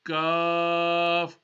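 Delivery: Kaf with a light, thin k at the front; thin Arabic Kaf. Shown as a demonstration. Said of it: "thinner sound".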